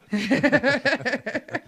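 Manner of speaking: high loud laugh bursting out; just burst out laughing; loud burst of laughter. Real, not acted. Laughing hard at the gross talk.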